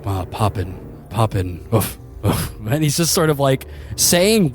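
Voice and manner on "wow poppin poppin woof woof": low, slow, dreamy dog voice; a blissed-out dog mumbling; dopey calm dog. Acted.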